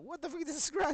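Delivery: high, loud mock outrage; shocked. Finding the scratch on his face, laughing outrage. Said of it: "I felt it".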